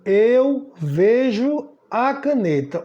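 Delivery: Eu vejo a caneta said very slowly, word by word; slow example sentence. Shown as a demonstration. Teacher showing an example sentence, slow and deliberate. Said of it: "Eu digo".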